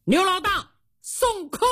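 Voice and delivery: high-pitched shout to someone outside; calling out. Acted. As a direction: holler the order out through the door. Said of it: "他冲外边喊了一嗓子".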